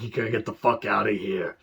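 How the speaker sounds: gruff Macho Man voice, imitating; a quick retold Randy Savage growl; mild Macho Man impression. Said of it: "Savage's like".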